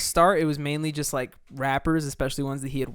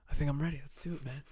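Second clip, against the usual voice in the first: chill, softer imitation of a musician; 8 dB quieter, faster than usual. Musician telling producer he's ready, lightly imitated chill. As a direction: lightly play him really chill, trailing quieter. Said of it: "that's exactly how Mac talks".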